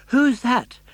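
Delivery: high, loud startled cry; startled gasp. Acted. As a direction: jump at the voice. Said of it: "gasped Pinocchio".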